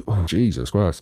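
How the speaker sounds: normal talking voice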